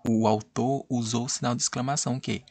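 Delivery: ordinary talk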